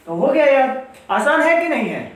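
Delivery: upbeat teacher encouragement; excited. Real, not acted. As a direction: sell how easy it is, beaming. Teacher cheering students on.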